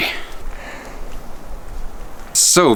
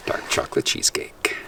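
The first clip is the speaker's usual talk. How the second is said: whisper; 9 dB quieter than usual. Real, not acted. Announcing the dessert course.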